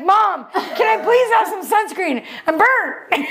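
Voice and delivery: mock child calling to mom, drawn out; kid begging. Son pleading with mom for sunscreen, high whiny kid voice.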